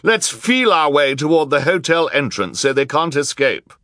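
high, loud urgent call; crowd shout. A narrator's reading. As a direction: shout directions to a milling crowd. Crowd shouting during a chase.

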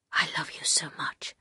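low, hushed lover's whisper. A narrator's reading. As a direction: whisper it softly as a declaration of love. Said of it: "he whispered".

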